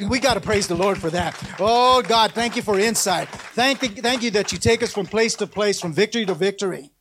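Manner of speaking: ordinary talk, nothing marked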